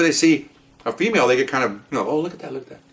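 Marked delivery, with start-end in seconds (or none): 2.1-2.8 softer, slightly higher bystander murmur; murmured onlookers. Retold or imitated. Onlookers spot a female officer, murmuring.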